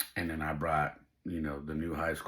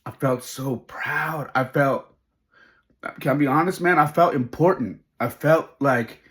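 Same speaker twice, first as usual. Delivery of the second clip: louder, higher, emotion rising; swelling pride; 7 semitones higher, 9 dB louder than usual. Real, not acted. Dad admitting he felt important, voice swelling with pride. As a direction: let real pride swell and push the voice up. Overcome with pride recalling the moment.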